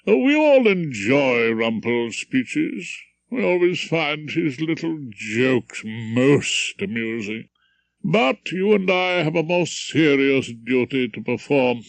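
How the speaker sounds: put-on pompous old judge addressing the jury; stern judge. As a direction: lecture the jury from the bench.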